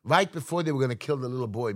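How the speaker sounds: normal talking voice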